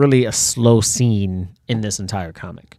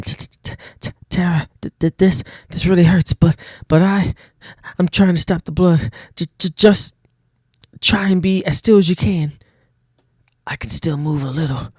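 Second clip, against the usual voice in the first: higher, louder stuttering voice; stammering in pain; 7 semitones higher, 4 dB louder than usual. Acted. Wounded Sarah reassures her sister, stammering higher.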